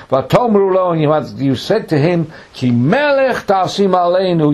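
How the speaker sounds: high, loud scriptural quotation; accusing declamation. Acted. Samuel quoting the people's demand back, declaimed. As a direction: throw their own demand back at them.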